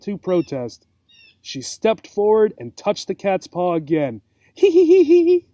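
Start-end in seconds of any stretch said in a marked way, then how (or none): 4.5-5.4 shrill giggles. Acted. Cat giggles at touch again.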